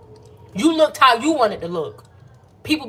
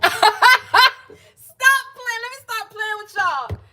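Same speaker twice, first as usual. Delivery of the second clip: much higher, laughing through the words; 13 semitones higher, much slower than usual. Real, not acted. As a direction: crack up breaking out of the whisper. Breaking character laughing.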